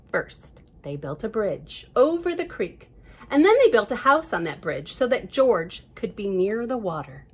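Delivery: plain reading voice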